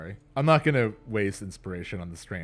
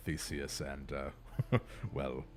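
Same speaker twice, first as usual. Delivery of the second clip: ox voice; 4 semitones lower, 5 dB quieter than usual. Acted. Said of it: "I'm Stud".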